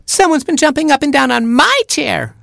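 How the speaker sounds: put-on very high, squealing baby chick; piercing little squeal of protest; screaming chick. A narrator's reading. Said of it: "screamed Baby Chick".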